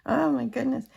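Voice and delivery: usual speaking voice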